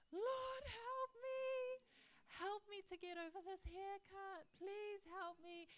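put-on high, pleading child's wail; imitating crying prayer. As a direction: act out a boy desperately begging God. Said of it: "he was crying, praying to God".

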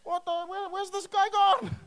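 high, loud bewildered cry; mock shock. Coming up out of the water, mock shocked shout. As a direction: cry out high as if someone just vanished. Acts out shock at Philip vanishing.

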